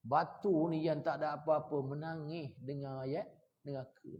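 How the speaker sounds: ordinary talk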